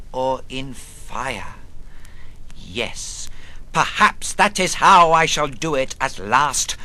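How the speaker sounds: usual speaking voice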